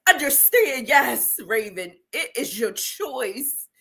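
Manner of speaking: ordinary talk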